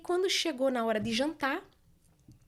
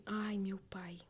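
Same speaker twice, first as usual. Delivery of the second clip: unvoiced, very soft "Ai, meu pai"; worried whisper; 13 dB quieter, much slower than usual. She worries aloud about her father, whispering. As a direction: breathe it out in worry. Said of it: "falei assim".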